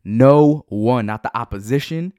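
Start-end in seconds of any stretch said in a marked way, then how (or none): 0.1-1.1 loud, forceful affirmation; punchy, raised-voice declaration; emphatic call-out. Shown as a demonstration. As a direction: belt it out for listeners to repeat. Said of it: "scream it in your car".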